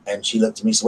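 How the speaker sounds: usual speaking voice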